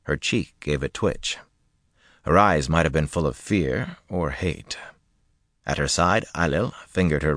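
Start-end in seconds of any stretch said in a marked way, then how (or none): none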